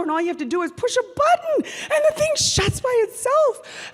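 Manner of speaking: loud, fast, pitch shooting up; excited marvel. Retold or imitated. Daughter showing mom the automatic door, excited marvel. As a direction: boast with giddy amazement.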